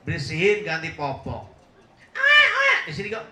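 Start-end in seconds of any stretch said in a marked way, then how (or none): 2.1-2.8 very high, loud imitation of a baby wailing; mock baby cry. Preacher imitating a crying baby to the congregation, high mock wail. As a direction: squeal it like a fussing infant.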